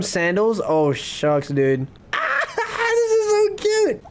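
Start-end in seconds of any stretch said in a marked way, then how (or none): 2.1-4.0 high, slow, near-crying squeal; squealing over cuteness. Real, not acted. Streamer overwhelmed by cute villager, squealing. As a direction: squeal like it's too cute to bear.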